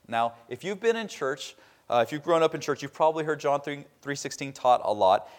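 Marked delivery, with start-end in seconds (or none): none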